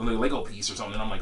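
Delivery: ordinary talk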